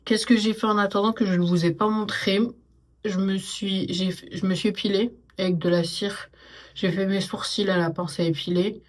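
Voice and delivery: usual speaking voice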